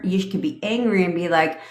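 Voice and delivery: ordinary delivery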